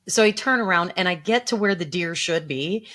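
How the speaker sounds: usual speaking voice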